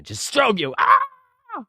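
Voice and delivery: sudden high, loud "Ah!"; mock strobe attack yell. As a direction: burst into a piercing playful cry. Demonstrating flashlight strobe, cries out.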